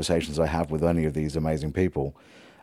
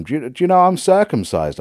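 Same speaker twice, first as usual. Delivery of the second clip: high, louder call after someone walking away; shouted after him; 10 semitones higher, 6 dB louder than usual. Retold or imitated. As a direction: yell it after him as a joke. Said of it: "I sort of shouted after him".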